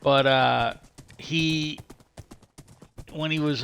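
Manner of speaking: usual speaking voice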